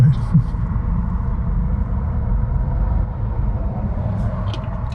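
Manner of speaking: unvoiced laughter; long breathy laugh. Real, not acted. Laughing at the joke.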